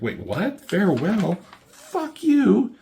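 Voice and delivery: rising to a high, loud furious yell; mock angry outburst. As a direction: blow up in outrage at the farewell. Voicing Superman's angry outburst.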